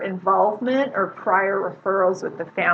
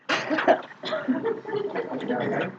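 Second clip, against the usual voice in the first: high, delighted laugh; pleased laugh at a good answer; 13 semitones higher, 4 dB quieter than usual. Real, not acted. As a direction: burst out in high-pitched laughter.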